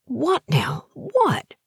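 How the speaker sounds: high-pitched, startled questions; startled questioning. A narrator's reading. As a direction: ask in alarm, pitch leaping up. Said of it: "startled and disheveled".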